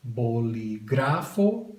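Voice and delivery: bolígrafo stressed on GRA, drawn out; stress on second-last syllable. Shown as a demonstration. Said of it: "underline where you pronounce the word".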